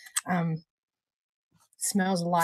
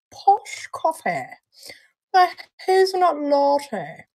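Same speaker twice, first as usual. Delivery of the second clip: slow, higher plummy mock posh; mock posh English; 7 semitones higher, slower than usual. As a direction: say it as if with a plum in your mouth, slowly. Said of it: "put a plum in my mouth".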